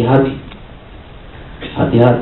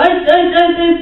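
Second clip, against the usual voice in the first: high mock accusing "eh, eh"; abrupt confrontation. Someone coming at a person who erred, mock accusing. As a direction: imitate someone barging in to scold.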